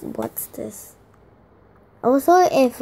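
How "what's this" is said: breathy unvoiced whisper to herself; curious little whisper over the box. Real, not acted. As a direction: hush it like peeking at a secret. Peeking into a gift box.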